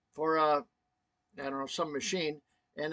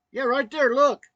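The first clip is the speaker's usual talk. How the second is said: high, loud urging to look; retold alarm, pitched up and loud; imitated urgent insistence; 9 semitones higher, 9 dB louder than usual. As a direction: act out her urgent pointing-out. Wife insisting he see the worm.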